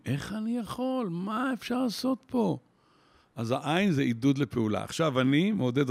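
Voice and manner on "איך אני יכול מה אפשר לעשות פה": high, slow, lost questioning; helpless voice. Retold or imitated. Person facing a crisis, high slow helpless questions. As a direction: sound helpless and stuck. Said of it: "חוסר אונים".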